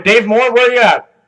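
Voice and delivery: loud, high holler across a crowd; calling out. Real, not acted. Host searching the audience for a man, calling out loudly. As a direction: raise your voice to find someone in the room.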